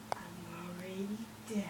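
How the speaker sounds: soft deadpan whisper. Acted. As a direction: whisper it flatly. Deadpan aside.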